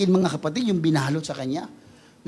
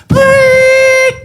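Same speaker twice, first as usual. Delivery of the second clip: a high, loud mock horn toot "Preet!"; horn blast imitation; 11 dB louder than usual. Preacher mimics a toy horn to deafen enemies, high loud toot.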